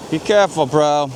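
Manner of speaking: usual speaking voice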